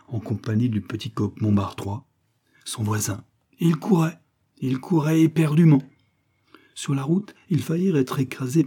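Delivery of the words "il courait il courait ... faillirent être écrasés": rising urgency. A narrator's reading. Frantic escape narrated.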